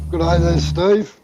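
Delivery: hoarse, croaky greeting; rough croaky throat. Real, not acted. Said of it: "Sorry about the croaky voice".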